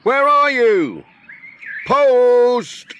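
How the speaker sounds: loud, high call of post. A narrator's reading. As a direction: call out loudly, slowly. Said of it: "shouted Pat".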